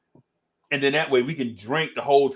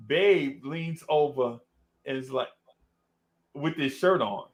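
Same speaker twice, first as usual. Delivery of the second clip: normal talking voice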